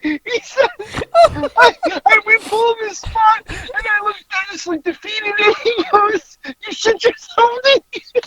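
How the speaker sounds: very high, teary laughing squeal; helpless laughter. Real, not acted. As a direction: push the words out while crying with laughter. Still helplessly laughing through the story.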